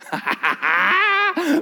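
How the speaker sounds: loud high burst of laughter; big laugh. Real, not acted. Preacher laughing at his own shoe gag, loud and high. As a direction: crack up at the joke.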